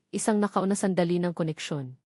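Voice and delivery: plain reading voice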